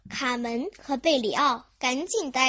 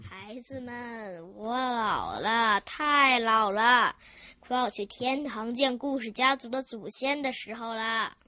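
put-on tearful old man; 3 semitones lower than usual. Grandpa tells the children he's dying, mournful. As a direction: drag it out like a grieving old grandpa. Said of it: "故事爷爷嚎啕大哭起来".

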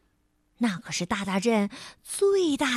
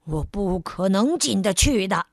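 gruff Mr Rude; 5 semitones lower than usual. Acted. Mr Rude voiced.

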